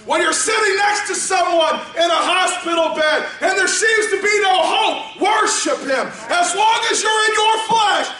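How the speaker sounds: high, loud call and refrain; preaching at full shout. Acted. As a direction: shout each call, drop on worship him. Repeated shouted exhortation.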